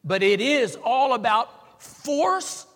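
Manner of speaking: slow, loud, high preacher's challenge; forceful preaching. Real, not acted. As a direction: hammer out each word at full force. Preacher challenges congregation at full force.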